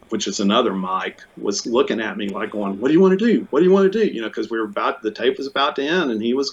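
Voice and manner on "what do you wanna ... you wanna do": high, loud, urgent repeated question; imitating an anxious engineer, high and loud. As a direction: press him for a decision, alarmed. Engineer panicking as tape ends.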